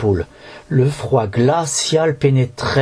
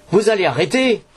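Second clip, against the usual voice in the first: mild shout; 7 semitones higher than usual. A narrator's reading. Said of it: "hurlai-je".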